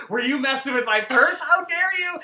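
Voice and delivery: rising, loud mock outrage; indignant accusation. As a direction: build to a high, scandalized accusation. Acted accusation over the purse.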